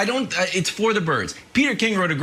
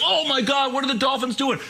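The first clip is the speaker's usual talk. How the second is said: mock shock; 6 semitones higher than usual. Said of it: "They're like".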